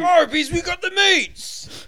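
high, slow shouted ad; yelled slogan. Acted. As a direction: drag out the yell. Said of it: "Just start yelling it".

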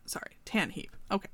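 plain reading voice

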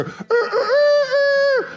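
high, drawn-out rooster crow; imitated cock-a-doodle cry, held long. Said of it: "we hear the Arakawa's rooster".

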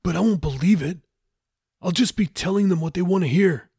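a hint of a pleading shipmate; lightly voiced Jeffy.

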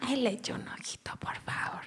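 soft pleading whisper. Retold or imitated. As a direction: breathe the favor request. Imitating mom again.

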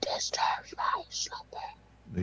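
very low, slow whispered rumble; Cave of Wonders voice. Retold or imitated. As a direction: rumble like a waking stone beast. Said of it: "impersonation of the Cave of Wonders".

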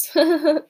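a short pleased chortle; a quick amused laugh at the joke. Acted. David laughs at Nate calling the sub a turtle, a chortle. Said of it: "David chortled".

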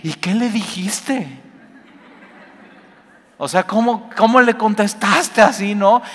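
asombro imitado, mucho más agudo; amiga asombrada. Retold or imitated. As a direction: imita a la amiga que no se lo cree. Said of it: "tu amiga hasta te dice". (amiga en el café, asombro exagerado)

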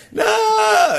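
put-on high, loud childish refusal; mock child wail. Dad imitates daughter balking at scooter, loud high wail.

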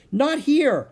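high, loud frantic warning; warning shout. A narrator's reading. Annie waving off the wildebeest, warning shout. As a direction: shout to turn them away. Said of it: "she shouted".

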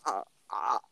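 talking with a phone in mouth; muffled sounds around a mouthful; mouth full. Real, not acted. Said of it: "How much of my phone ... fit in my mouth".

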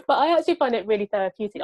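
normal talking voice

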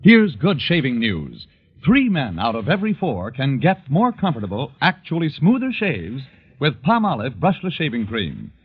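usual speaking voice

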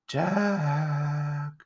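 long drawn-out, eerie moaning call; wailing phantom summons; ghostly moan. A narrator's reading. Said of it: "moaned a voice".

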